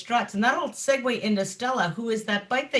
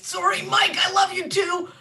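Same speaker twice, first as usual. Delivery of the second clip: playful shout; 9 semitones higher than usual. Real, not acted. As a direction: call out an affectionate sorry. Apologizing to an absent mechanic.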